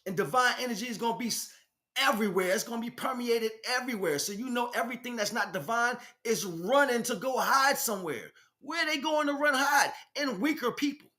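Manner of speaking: normal talking voice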